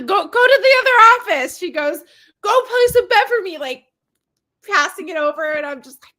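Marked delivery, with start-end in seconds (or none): 0.0-1.6 high, loud, urgent command; hammed-up frantic order; mock shouted order. Acted order during a fight. 2.4-3.6 high, loud, insistent order; hammed-up pushy demand; mock yelled demand. Acted order retold from fight scene.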